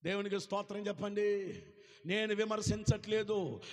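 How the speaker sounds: usual speaking voice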